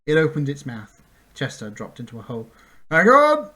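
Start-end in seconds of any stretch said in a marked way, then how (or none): 2.9-3.5 loud, much higher shout; cried out. A narrator's reading. Centaur warning Bink before the drop, a loud shout. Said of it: "the centaur cried".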